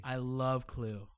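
slow, drawn-out love; a slow, emphatic I love Clue, said like a fan; gushing. Real, not acted. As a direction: savour each word of I love Clue.

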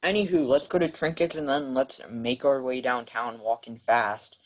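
ordinary talk

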